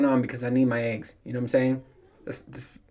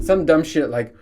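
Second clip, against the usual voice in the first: normal talking voice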